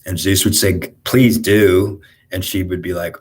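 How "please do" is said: mock retort, louder than the telling; emphatic retort. Zeus answering his wife's threat to leave, emphatic retort. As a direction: throw it back louder, imitating Zeus.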